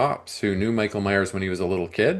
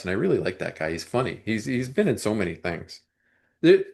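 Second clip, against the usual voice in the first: ordinary delivery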